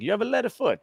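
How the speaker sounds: high-pitched imitation of an old Italian man; hammed-up Italian accent. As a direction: scold like an old Italian driving teacher. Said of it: "he's this old Italian man".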